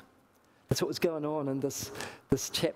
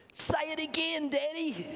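put-on high, eager child voice; small girl voice, pitched well up; delighted little girl; 10 semitones higher than usual. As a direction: plead for more like a delighted little daughter. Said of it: "His little girl's face lit up".

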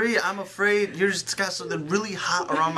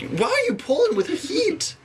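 high pained protest. Real, not acted. Burnt by straightener.

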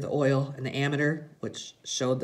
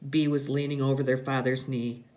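ordinary delivery

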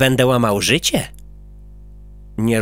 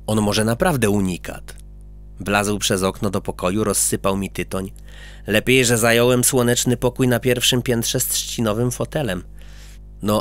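ordinary delivery

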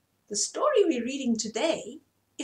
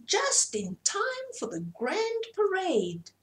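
very high, slow peacock call; grand peacock announcing the parade, high and slow; proud peacock; 9 semitones higher than usual. Acted. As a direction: sing it out high like a showy bird. Peacock voiced in story.

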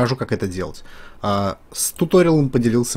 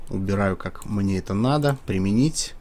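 normal talking voice

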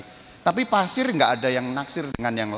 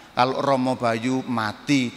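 ordinary talk

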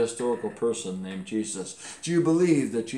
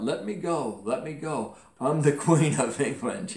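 a lightly raised mock cry with a laugh at the end; shouted out; 4 semitones higher, 5 dB louder than usual. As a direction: copy the other boys, with a laugh at the end. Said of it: "hearing the words shouted out".

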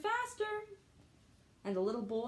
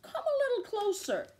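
crafty fox; 12 semitones higher, 3 dB louder than usual. Acted. Fox luring the gingerbread man closer, sly and high. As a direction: lure him in sweetly.

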